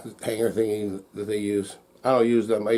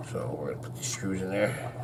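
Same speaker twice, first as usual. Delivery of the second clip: whisper; 6 semitones lower, 10 dB quieter than usual. Real, not acted. Working quietly on the screws.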